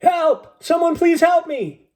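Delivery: high, loud terrified plea; desperate cry for help. Acted. Drowning sailor begs anyone for help, high and panicked. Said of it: "More voices joined in".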